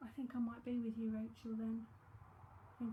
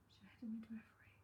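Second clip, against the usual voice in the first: a voiceless whisper, much softer; whispered aside; 10 dB quieter, faster than usual. Real, not acted. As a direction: mutter the question under your breath.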